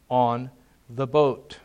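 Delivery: ordinary talk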